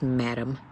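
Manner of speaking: "madam." pronounced "matem", with a t sound in the middle. A narrator's reading. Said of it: "She pronounced it matem".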